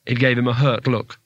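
usual speaking voice

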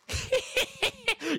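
shrieking imitated creature cry; high shriek.

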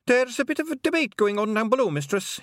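cheeky wee Scots pixie; Scottish pixie. A narrator's reading. Feegle pixie voiced in story.

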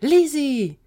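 loud, high joyful call; happy shout. A narrator's reading. Gary spots Lizzie approaching, shouting happily. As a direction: shout out in delighted relief. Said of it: "Gary shouted happily".

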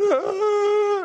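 short high sobbing "Ah"; retold sobbing cry. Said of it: "Why are you crying?".

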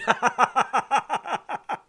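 short real laugh at a comment. Real, not acted.

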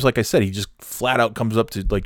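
ordinary delivery

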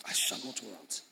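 "I shall not want" murmured softly and low, NOT weighted; muttered verse. Shown as a demonstration. Pastor repeats the muttered verse, soft and low. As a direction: say it quietly to yourself. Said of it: "speaking the scripture to yourself".

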